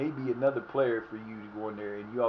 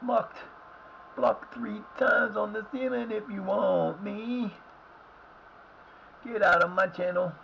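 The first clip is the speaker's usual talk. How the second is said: mock dismissal; 7 semitones higher, slower than usual. Mock-dismissing unwanted visitors.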